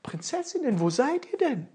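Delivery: laut und hoch hinterhergerufen; hinterherrufend. Acted. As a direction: ruft den fliehenden Prinzessinnen nach. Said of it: "Ofenmichel rief noch hinterher". (Ofenmichel ruft den Prinzessinnen hinterher, laut)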